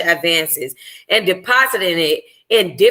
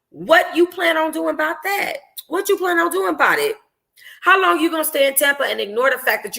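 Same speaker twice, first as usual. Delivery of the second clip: higher, lively best-friend voice; best friend Dior reacting to baby news, higher and excited; Dior's voice; 7 semitones higher than usual.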